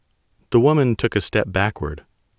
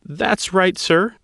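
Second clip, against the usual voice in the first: tremor; 12 semitones higher, slower than usual. Acted. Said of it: "an obvious tremor in her voice".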